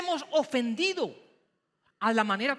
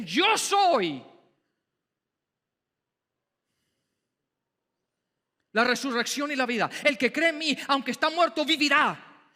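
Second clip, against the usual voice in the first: raised voice. Acted. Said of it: "Jesús pareciese que levantara la voz".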